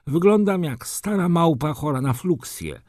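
high, put-on voice of a wounded aristocrat; complaining baron. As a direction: moan about it in a high voice. Disfigured baron laments his looks. (wysoki głos użalającego się barona)

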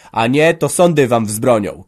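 loud, slow-hammered threat; enraged shout. A narrator's reading. Son threatens father with courts, shouting in rage. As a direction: burst out, past all patience. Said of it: "krzyknął, bo już nie mógł ścierpieć i buchnął zapamiętałością".

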